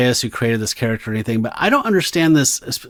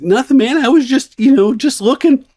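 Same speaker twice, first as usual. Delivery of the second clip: put-on much higher and louder, a squeaky scared kid; squeaky hippie; 11 semitones higher, 4 dB louder than usual. On the retake, the hippie squeaks his answer to the vampire. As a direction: push the pitch way up like a startled boy. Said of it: "Take two".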